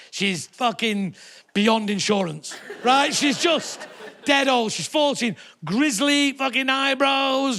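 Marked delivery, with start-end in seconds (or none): none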